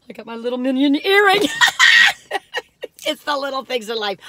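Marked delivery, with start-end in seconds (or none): 1.5-3.0 very high delighted squeal of a laugh. Real, not acted. Showing off her Minion earring, high gleeful squeal. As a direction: squeal with glee.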